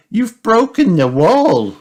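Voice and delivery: high, loud, drawn-out cry; high-pitched dismayed complaint. A narrator's reading. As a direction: wail it slowly as an upset child character. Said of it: "cries Peppa".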